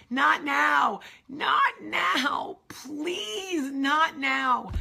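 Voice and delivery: high, loud, half-whispered pleading; desperate plea. Retold or imitated. Alone in the stall, pleading in mock panic. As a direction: beg the universe in mock panic.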